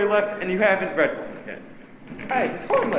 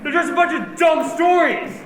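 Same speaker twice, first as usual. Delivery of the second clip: scoffing shout; 6 semitones higher than usual. Acted. Boy mocks his scared friends with a scoffing shout. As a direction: shout it to show you aren't scared.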